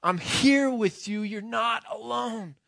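high, loud cry of comfort; retold scream. Prisoner screaming to his friend at the gallows, high and loud. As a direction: shout it to him across the yard, then hold it. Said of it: "he screams and says".

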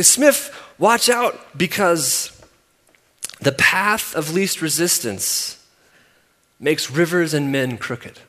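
slow, loud Southern coach warning. Retold or imitated. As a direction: belt the Southern coach's saying slowly. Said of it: "He had this super thick Southern accent".

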